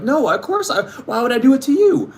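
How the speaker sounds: high, whiny mock denial; mock innocent denial. Accused person denying to friends, high mock innocence. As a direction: play innocent in a high whine. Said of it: "they're like".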